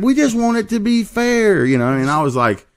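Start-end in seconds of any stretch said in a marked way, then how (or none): 0.0-1.7 high, slow mock of his pleading; mock naive racer. Teller repeats the racer's line, high mock voice. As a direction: draw it out like a naive kid. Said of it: "he kept saying".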